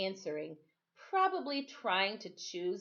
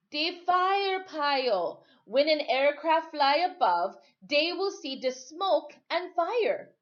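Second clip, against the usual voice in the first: put-on higher, slow old sailor voice; 8 semitones higher, 6 dB louder, slower than usual. Timothy explains rescue plan.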